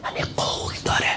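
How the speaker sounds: hushed aside; whispered aside to the crowd. Real, not acted. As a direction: drop to a whisper.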